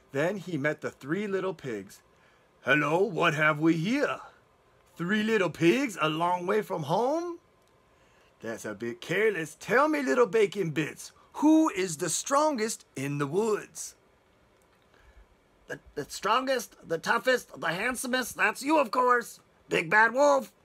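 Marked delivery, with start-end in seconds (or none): 2.6-14.0 put-on the wolf savouring every word to the girl; sly wolf. Wolf greets the pigs. 15.7-20.5 lightly voiced piglets, higher, stammering praise; little pigs hurrying to please the wolf; frightened piglets. Piglets stammering flattery to the wolf, a touch higher. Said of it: "the piglets cried together".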